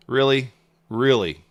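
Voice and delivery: loud incredulous really, twice; exasperated disbelief. Real, not acted. Trader reacting to the price hitting stop, loud disbelief.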